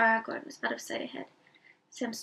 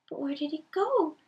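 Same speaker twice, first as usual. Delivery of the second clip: higher, worried crying out; anxious cry; 6 semitones higher, 5 dB louder than usual. Acted. Pippin frets over the missing Frodo, anxiously crying. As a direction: call out in a rising worry. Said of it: "cried Pippin".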